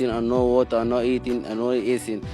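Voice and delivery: ordinary talk, nothing marked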